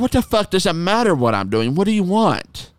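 replaying his irritated answer; retold angry retort. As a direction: snap back at the pest. Retelling his angry reply.

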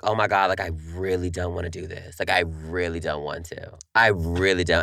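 dreading it, moaning; reluctant whine. Retold or imitated. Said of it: "'Cause I'm like".

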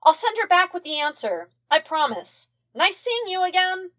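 high, bright goodbye; cheerful kid voice. A narrator's reading. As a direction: chirp it happily. Aang's cheerful farewell.

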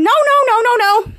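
loud, high repeated no; frantic high-pitched no no no; shouting no. Acted. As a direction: shout them down to stop her. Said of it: "Just shout out".

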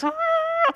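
very high-pitched fake bawl; hammed-up high wail; mock crying wail. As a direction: imitate a child's drawn-out howl. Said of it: "gue sambil nangis gitulah".

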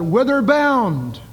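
long drawn-out hail across the water; calling out to another ship. Retold or imitated. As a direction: call it slowly like a sailor hailing a passing ship. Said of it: "call out".

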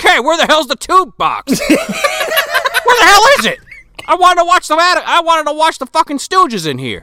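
high, loud mock outrage; Charnetsky. Wizard's guest hunting for the TV, loud mock outrage. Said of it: "Charnetsky goes into, like, one of".

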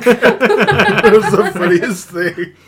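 laughing. Real, not acted. Laughing over a story.

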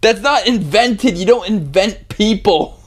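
high, incredulous protest; exasperated. Real, not acted. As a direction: push back in disbelief. Exasperated objection in argument.

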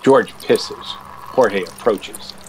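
plain reading voice

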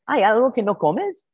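voz más aguda de señora madrileña; señora imitada. Retold or imitated. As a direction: imita a la casera preguntando. Anfitriona madrileña voiced in story. (voz aguda de anciana casera)

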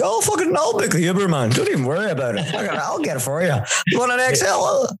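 high breezy offer, ending in a shout; flashy loaded mate. Retold or imitated. Comedian mocks a loaded mate offering to pay, breezy and high. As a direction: play the rich pal who'll pay for everything.

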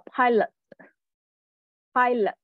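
pilot drawn out slowly twice; teacher modeling pilot. Shown as a demonstration. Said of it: "下面呢".

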